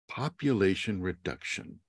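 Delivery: ominous quiet echo. Acted. Quiet echo of previous line.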